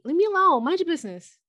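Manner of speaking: high, loud playful retort; mock indignation. Friend backs her up against nosy questioners, mock indignant. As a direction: playfully send the nosy ones away.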